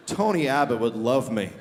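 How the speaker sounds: ordinary delivery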